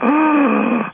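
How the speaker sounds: loud, high mock swoon; swooning gasp. Shown as a demonstration. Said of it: "swoon and fall across the table like".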